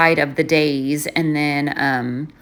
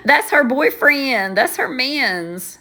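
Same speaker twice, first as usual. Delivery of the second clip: teasing; 7 semitones higher, slower than usual. Real, not acted. Teasing about mom's crush.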